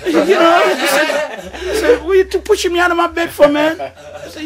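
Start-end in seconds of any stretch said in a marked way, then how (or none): 2.0-3.8 laughing protest. Retold or imitated. Scolding the ghost, laughing.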